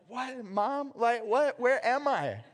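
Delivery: high-pitched, bewildered questions; jolted awake, not knowing where you are; dazed waking Jonah. Retold or imitated.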